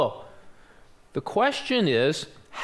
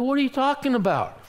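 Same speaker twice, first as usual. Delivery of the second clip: quick, high-pitched disbelief; retold incredulity; 9 semitones higher, faster than usual. Guide disputing a stranger's warning, incredulous. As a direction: toss it back fast and high, incredulous.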